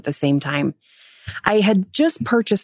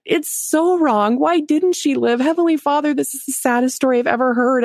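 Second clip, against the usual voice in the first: hammed-up weepy lament, pitched high; mock crying; 7 semitones higher than usual. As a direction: act out a tearful plea to heaven. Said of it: "then I started crying and I'm like".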